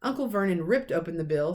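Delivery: ordinary delivery, nothing marked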